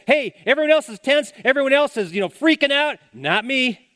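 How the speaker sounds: high, loud rallying cry; confident shout. Pretend. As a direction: rise above the panic, loud. Thermostat voice defying panic.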